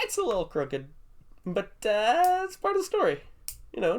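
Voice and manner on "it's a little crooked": ordinary delivery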